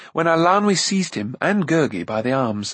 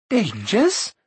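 high, rising exclamation; incredulous cry; 9 semitones higher than usual. A narrator's reading. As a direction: throw the word back, pitched way up. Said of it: "cried Eilonwy".

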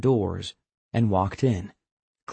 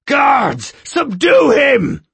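high, loud, slow shout; 9 dB louder, much slower than usual. A narrator's reading. Skemma ordering guards against Hatch, shouting. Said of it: "Skemma shouted".

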